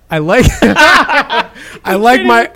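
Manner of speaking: slow, laughing restart; giggling, trying to read; after laughing hard. Real, not acted. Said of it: "I'm just gonna go for it".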